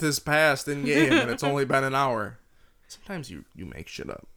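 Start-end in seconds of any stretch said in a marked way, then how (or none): 0.0-2.4 loud, gushing parody of a fan; mocking enthusiasm. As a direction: ham up the fake excitement. Mocking friend's favourite game feature.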